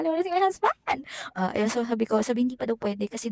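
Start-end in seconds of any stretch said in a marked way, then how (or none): 0.0-1.0 higher, tearful pleading for her husband; tearful plea. Retold or imitated. Patient in recovery room begging nurse for husband, tearful higher plea. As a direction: beg through tears, voice pitched up. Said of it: "umiiyak ako".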